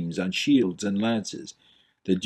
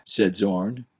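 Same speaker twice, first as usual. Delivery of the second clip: ordinary delivery, nothing marked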